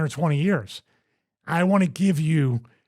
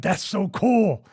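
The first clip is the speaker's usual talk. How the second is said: put-on drawn-out, wowed kid reaction; retold fan's delight; 4 semitones higher, slower than usual. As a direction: imitate your own starstruck delight, slowly. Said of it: "And I would be like".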